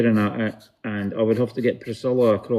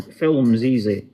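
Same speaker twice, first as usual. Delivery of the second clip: ordinary delivery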